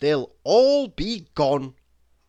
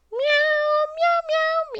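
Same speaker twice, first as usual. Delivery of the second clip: cat meowing. Pretend. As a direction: meow it high and cute instead of the insult. Ends insult with cat noises.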